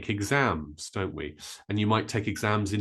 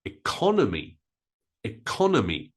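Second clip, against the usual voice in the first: economy stressed on second syllable, ih-KON-uh-mee; different stress. Shown as a demonstration. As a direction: hit CON in economy. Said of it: "the stress is different".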